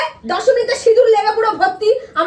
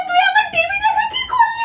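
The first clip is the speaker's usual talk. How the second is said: much higher crying shout; 10 semitones higher than usual. Acted. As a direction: wail at her over the smashed TV. Wife smashes the TV.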